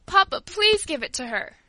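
acted tearful child's appeal; tearful plea. A narrator's reading. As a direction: beg a father on someone's behalf. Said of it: "burst into tears".

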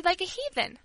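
"like a heathen" high, falling at the end; firm confirmation. A narrator's reading. Girl confirms her charge to the servant, firmly. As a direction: repeat it as a settled fact.

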